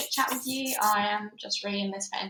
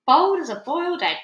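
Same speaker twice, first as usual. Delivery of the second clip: grandmother voice; 7 semitones higher, 8 dB louder than usual. Acted. Grandmother answers boy.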